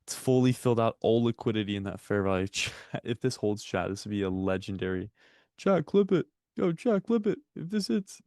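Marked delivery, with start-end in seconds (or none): 5.6-8.2 excited. Real, not acted. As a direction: hype them to clip it. Excited hype, clip request.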